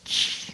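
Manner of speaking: put-on drawn-out baby "shh"; imitated hiss. Dad imitates his baby saying H, long hiss. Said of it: "be like".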